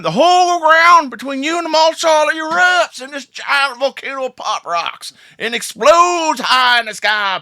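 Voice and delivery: excited narration. Acted. DM narrates volcano eruption.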